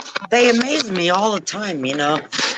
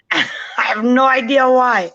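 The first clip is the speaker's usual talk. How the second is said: ordinary delivery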